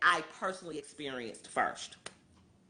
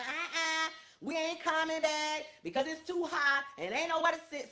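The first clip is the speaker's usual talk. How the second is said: leaving guests; 6 semitones higher than usual. Retold or imitated. Leaving guests voiced refusing.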